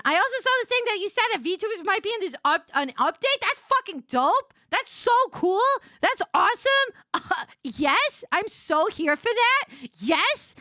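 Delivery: high, loud excited gushing. Real, not acted. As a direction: burst out with delight. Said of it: "I got very excited about that".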